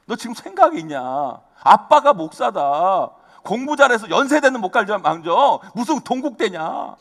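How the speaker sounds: slow, emphatic mock scolding of his daughter; retold scolding. Pastor father retelling how he scolded his daughter, slow stern mock scolding. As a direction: drag out each word like a stern pastor father. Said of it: "엄청 야단쳤어요".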